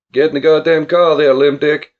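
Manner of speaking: loud, insistent projected order; megaphone call. A narrator's reading. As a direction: bellow it as if into a megaphone. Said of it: "to the megaphone he spoke again".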